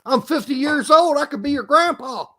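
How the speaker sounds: loud, high mock indignation; mock outrage. Friend calls him boy, he fires back in loud mock outrage. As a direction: bristle at being called boy, half joking.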